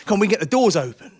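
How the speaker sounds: loud, acted call to a mate; rally him with a loud push; urgent urging. Passenger urging his mate to force doors, loud urging.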